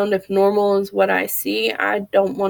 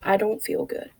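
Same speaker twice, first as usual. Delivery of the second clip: quieter, letting it sink in; soft and low; 5 dB quieter than usual. Retold or imitated. Repeating a depressed person's words, softly. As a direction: say it softly, like it's heavy. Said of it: "Three simple words".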